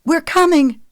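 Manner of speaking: high, loud call into the distance. A narrator's reading. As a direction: shout so the lost boy hears. Calling to a lost child.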